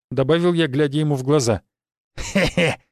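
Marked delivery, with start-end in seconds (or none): 2.2-2.8 dry little "heh-heh"; amused character's small laugh; short chuckle. A narrator's reading. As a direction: give a brief acted chuckle. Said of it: "усмехнулся Финн".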